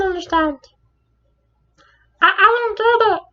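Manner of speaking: very high, loud, slow frog-puppet voice; high, loud, drawn-out Kermit-style bewilderment; Kermit impression. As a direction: squeal it out like the frog puppet in a panic. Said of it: "Kermit, when did you get here?".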